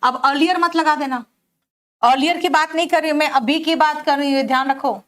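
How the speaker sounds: ordinary talk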